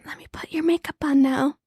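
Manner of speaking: lightly mocked ASMR makeup artist; ASMR role play. Co-host pretends to do the listener's makeup, mock ASMR. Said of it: "the role play?".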